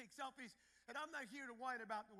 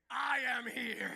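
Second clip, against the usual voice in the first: imitating the groom; 13 dB louder, much slower than usual. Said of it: "I know what he'll be saying next week".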